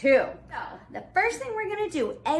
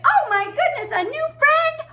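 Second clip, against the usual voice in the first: high, loud gush of delight; excited exclamation; 9 semitones higher, 5 dB louder than usual. Shown as a demonstration. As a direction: model amazed excitement for preschoolers. Models excited reaction for children.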